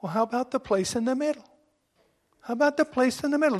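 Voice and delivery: mildly imitating a small child; lightly voiced little girl. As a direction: ask sweetly like a three-year-old. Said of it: "Christina responds".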